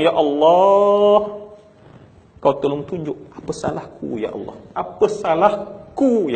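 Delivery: high, loud drawn-out cry to God, slow on Ya Allah and Apa salahku; wailing prayer. Retold or imitated. Preacher retelling a father's wailing plea to God, high and loud. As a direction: stretch it out like a wail toward heaven. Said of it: "saya meraung".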